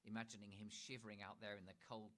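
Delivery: usual speaking voice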